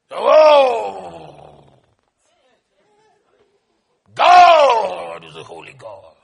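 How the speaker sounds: loud drawn-out cry, sinking low at the end; mock-solemn booming. Preacher mimicking people's idea of holiness, booming solemnly. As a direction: boom it out with heavy, exaggerated awe.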